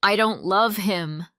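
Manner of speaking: loud anguished cry. A narrator's reading. Sarah refusing her father, crying out loud. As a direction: throw it at him. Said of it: "Sarah cried".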